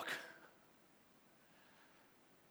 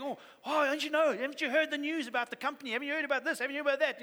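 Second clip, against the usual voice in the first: higher, louder breathless gossip; eager office gossip; 7 semitones higher, 4 dB louder, faster than usual. Retold or imitated. Coworker spreading company news to a colleague, eager gossip. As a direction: lean in, thrilled to share the rumour.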